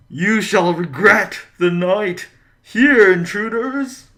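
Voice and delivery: high, slow, booming spectral voice; ghost voice. Acted. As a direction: loom over the intruders with a slow ghostly threat. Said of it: "Do you like my ghost voice?".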